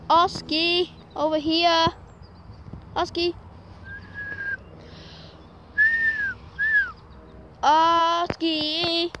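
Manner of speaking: high, loud calls across a field; calling from far away. Real, not acted. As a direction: call the dog from a distance.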